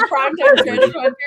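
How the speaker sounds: booming, high announcer hype; monster truck ad. Shown as a demonstration. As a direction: yell it like a monster truck rally promo. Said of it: "your best monster truck announcer voice".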